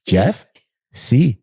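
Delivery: put-on brief questioning Spanish-accented reply; imitated Spanish accent. Tow driver answering about the title, imitated Spanish accent. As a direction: lightly mimic a man with little English, Spanish accent. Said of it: "some Spanish, uh, speaking guy".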